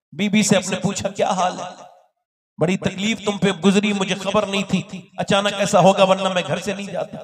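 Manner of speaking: builds from a steady telling to a loud, high preaching climax; rising sermon storytelling. Acted. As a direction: preach the story, climbing in force. (चढ़ता हुआ जोशीला was)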